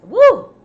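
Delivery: high, loud "woo"; big high exhale of relief from coughing; coughing-fit whoop. Real, not acted. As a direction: whoop it out after a hard cough. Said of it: "all this coughing".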